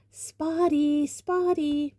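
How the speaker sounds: high, sing-out mocking call; taunting yell. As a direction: call the nickname after someone fleeing.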